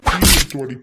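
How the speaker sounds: loud, sudden angry shout; angry order. Acted. Husband ordering his wife to bring tea, angry shout.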